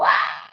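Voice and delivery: mock scream of fright, like skiing into a tree; short imitated yell, heading straight for the tree; retold scream. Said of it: "Me puse a gritar".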